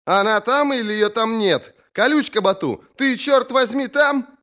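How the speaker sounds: high, insistent questioning through a door; impatient sister, voice pitched very high; exasperated sister. A narrator's reading. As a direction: demand an answer, pitch climbing steeply.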